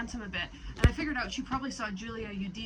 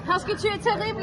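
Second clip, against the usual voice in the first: loud teasing; 10 semitones higher, 9 dB louder than usual. Real, not acted. Teasing friend about her accent.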